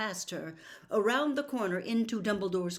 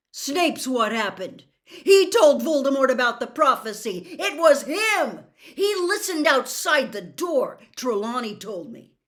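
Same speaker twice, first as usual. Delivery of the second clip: loud, raised, furious accusation; angry outburst; 6 semitones higher, 12 dB louder than usual. Acted. Harry telling Dumbledore Snape betrayed his parents, loud furious outburst. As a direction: hurl the facts at him in rage.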